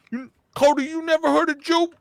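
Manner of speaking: loud, very high incredulous comedian impression; Tracy Morgan. Comedian challenges the host who doubts him, high loud impression. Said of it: "He's like".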